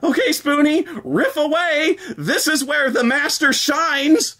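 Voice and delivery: loud, high mock hype; sarcastic self pep talk. Talking to himself before riffing, loud mock hype. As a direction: psych yourself up with bitter irony. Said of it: "self-mocking pep talk".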